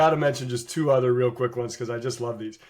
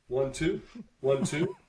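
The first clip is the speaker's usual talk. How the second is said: Seabass counting; 5 dB quieter, slower than usual. Retold or imitated. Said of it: "and Seabass goes".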